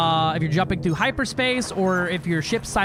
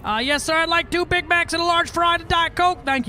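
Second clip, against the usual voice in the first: drive-through customer; 10 semitones higher than usual. Pretend.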